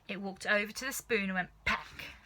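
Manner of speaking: ordinary delivery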